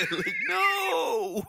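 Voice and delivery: high, loud laughing protest; mock shout. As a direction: squeal out a laughing no.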